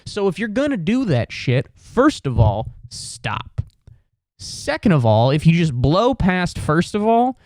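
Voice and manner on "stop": whispered stop. Acted. Telling people to stop.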